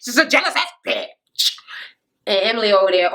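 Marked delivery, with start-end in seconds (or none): 0.0-1.6 put-on voice, a playful imitation; mocking impression after a laugh; Amanda. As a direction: mimic how the cast member talks. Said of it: "That's how she talk, right?".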